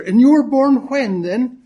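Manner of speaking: put-on high, loud old Irish gent's voice; old Irishman. Old gent quizzing his drinking partner, in a high Irish old-man voice. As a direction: pipe up like a chatty old codger.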